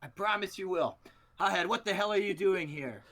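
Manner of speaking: high, loud challenge to a rival; wrestling promo. Acted. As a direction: cut a hammed-up promo at your foe. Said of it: "Promo back in".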